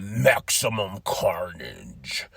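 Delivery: low, voiceless dramatic whisper; dramatic hushed hype. Real, not acted. Host announcing the coming show, dramatic whisper. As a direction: whisper the event name ominously.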